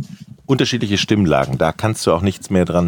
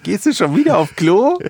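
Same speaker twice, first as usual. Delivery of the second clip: put-on much higher child voice, teasing; young daughter voice; 10 semitones higher than usual. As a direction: play the daughter, higher and cheeky. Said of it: "Meine Tochter sagt eigentlich".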